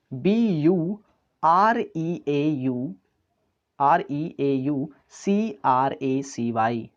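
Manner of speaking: letters dictated very slowly; slow spelling. Shown as a demonstration. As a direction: spell it out for the class. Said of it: "spelling a word aloud". (बहुत धीरे spelling बोलना)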